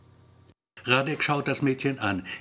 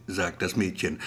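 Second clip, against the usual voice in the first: ordinary delivery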